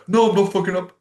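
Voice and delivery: high, loud defensive yell; mock high, loud denial; defensive protest. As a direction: snap back like a stubborn kid. Acted quarrel with a blunt mate.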